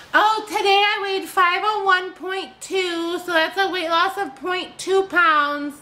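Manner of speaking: high, slow mocking parody voice; hammed-up high mock voice, slowed way down; mocking imitation. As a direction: mimic her tiny weigh-in brag in a high, drawn-out voice. Mocking her weight-loss update.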